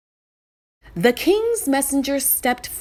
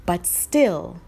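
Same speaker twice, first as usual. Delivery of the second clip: ordinary delivery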